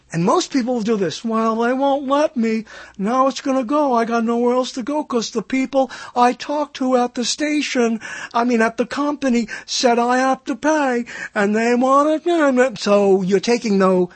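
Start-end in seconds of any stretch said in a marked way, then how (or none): 1.2-12.8 helpless complaining customer. Acted. What most people do when the company says pay, mock whining. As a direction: moan in a put-on, defeated voice. Voicing customer who gives up.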